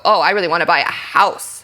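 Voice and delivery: emphasis landing on "house" at the end; stress on house. Shown as a demonstration. Actor answering talk of apartment living, stress on "house". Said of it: "need to emphasize the word house".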